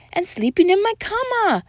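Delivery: high little doggy voice; small amigo complaint. A narrator's reading. Seventh amigo complains in story.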